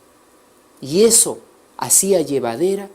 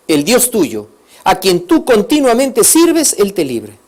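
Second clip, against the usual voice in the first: raised, slow, stretched plea; king's loud blessing; 5 semitones higher, 8 dB louder than usual. Acted. King blesses Daniel before the lions' den, loud and pleading. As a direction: call it out like a worried king.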